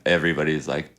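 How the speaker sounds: ordinary delivery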